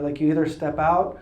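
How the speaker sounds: ordinary talk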